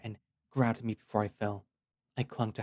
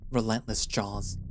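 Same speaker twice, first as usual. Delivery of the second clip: ordinary delivery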